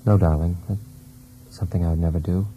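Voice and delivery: a husband's hushed vow to his wife; tender reassurance. Acted.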